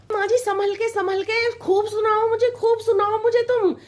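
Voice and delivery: high, sugary daughter-in-law voice; sweet young bride. Acted. Bride soothing her coughing mother-in-law, high and sugary. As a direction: sing it up sweetly, a little cheeky.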